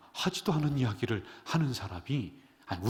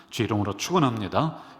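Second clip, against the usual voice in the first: louder preached blessing; 11 dB louder than usual. Real, not acted. Blessing repeated with more force.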